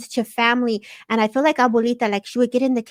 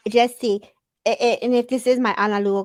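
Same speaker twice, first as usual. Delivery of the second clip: ordinary delivery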